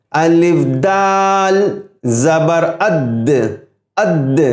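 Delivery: slow الف دال زبر اد with a hard, heavy د; heavy دال demo. Shown as a demonstration. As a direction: spell it slowly and land hard on اد. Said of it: "اس میں dashing sound ہے". (دال کی سخت آواز)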